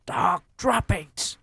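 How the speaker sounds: high, loud witch yell. Acted. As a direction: yell the alarm. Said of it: "she yelled".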